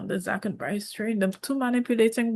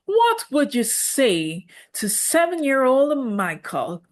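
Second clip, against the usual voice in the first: slow, higher, lightly voiced host question; 5 semitones higher, 3 dB louder, slower than usual. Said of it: "[name] will ask the queens".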